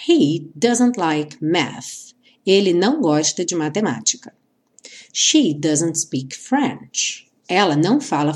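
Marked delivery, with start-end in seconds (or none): 0.1-2.1 slow example read. Shown as a demonstration. Teacher reading example slowly. 5.1-7.3 slow example read. Shown as a demonstration. Teacher reading example slowly.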